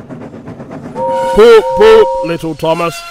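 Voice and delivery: loud, hooted train-whistle toot; whistling engine. A narrator's reading. Gordon arrives to rescue Thomas, loud whistle hooting. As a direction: toot the poops like a steam whistle. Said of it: "he whistled".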